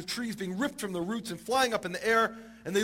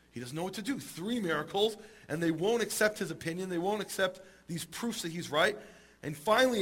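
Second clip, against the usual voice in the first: ordinary talk, nothing marked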